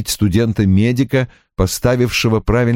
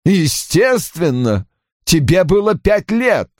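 high, testy father's retort; drawn-out, exasperated first word; snapping retort; 10 semitones higher, slower than usual. A narrator's reading. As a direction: snap it irritably, without shouting. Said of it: "рявкнул Рой".

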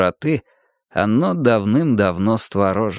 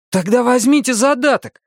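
very high, urgent offer; desperate plea; 13 semitones higher than usual. A narrator's reading. Arnie begging the seller to take a deposit, desperate. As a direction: push the offer desperately, pitched right up.

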